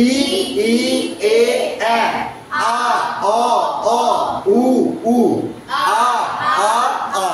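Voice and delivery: steady chant from "ই" through "উ" to "আ"; teacher leading "ই, ই, এ, আ" and on, evenly spaced; twelve-vowel drill. Shown as a demonstration. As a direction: sound out each vowel from "ই" to "আ" separately. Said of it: "একসাথে বারোটা এবার করবো".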